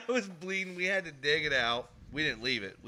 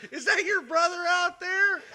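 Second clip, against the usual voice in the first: high, loud, giggling through mom's words; can't hold the laugh while quoting mom; retelling mom's question while cracking up; laughing quote; 10 dB louder than usual. Retold or imitated.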